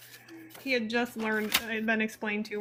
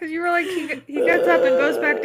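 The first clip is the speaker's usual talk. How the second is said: talking through real laughter; words spill out between laughs; laughing; 6 semitones higher, 11 dB louder than usual. Real, not acted. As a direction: laugh while you say it. Cracking up at innuendo.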